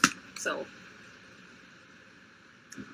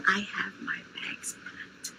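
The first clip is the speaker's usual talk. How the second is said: whispering; 6 dB quieter, slower than usual. Real, not acted. Whispering while hiding the trip.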